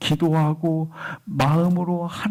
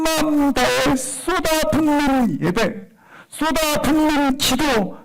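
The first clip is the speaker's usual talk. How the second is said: high, ringing, insistent proclamation; impassioned preaching; 9 semitones higher than usual. Real, not acted. Preacher urging the congregation to pour out prayer, impassioned preaching. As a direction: build the repeated phrases at a high, stretched pitch.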